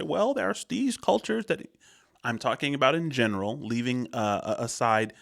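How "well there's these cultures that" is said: high, drawn-out nerd objection; mock nerd. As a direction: imitate a nitpicking nerd, pitched way up. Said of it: "the nerds that come after me".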